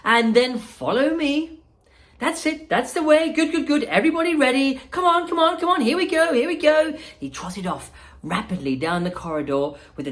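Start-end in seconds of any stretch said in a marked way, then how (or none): 0.0-6.9 high, louder showman calling over noise; raised voice. Acted. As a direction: call out over the machines. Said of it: "raising his voice above the noise".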